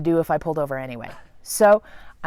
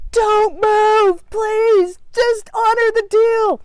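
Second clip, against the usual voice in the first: much higher, pleading and slow; desperate plea; 14 semitones higher, 5 dB louder, much slower than usual. Retold or imitated. She pleads with the spider, high and desperate. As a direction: beg the spider to stay put.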